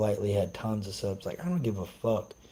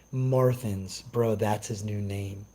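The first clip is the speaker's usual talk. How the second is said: normal talking voice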